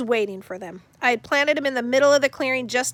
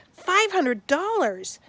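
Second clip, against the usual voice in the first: awed; 7 semitones higher, much slower than usual. Acted. Awed at the reward.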